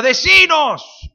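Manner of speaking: high, slow shouted demand. A narrator's reading. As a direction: shout out to the stranger, drawn out. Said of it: "Y uno grita". (grito agudo y lento)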